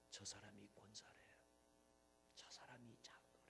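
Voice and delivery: low murmur, fading almost to a whisper; hushed gossip. Acted. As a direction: pass it on under your breath. Gossip continues, sinking in volume.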